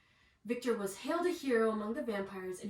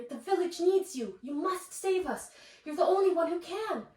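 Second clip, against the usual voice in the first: higher, louder urgent pleading; urgent plea; 8 semitones higher, 7 dB louder than usual. A narrator's reading. Mother begging Victor to save the village, urgent and loud. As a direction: press him, the whole village depends on it.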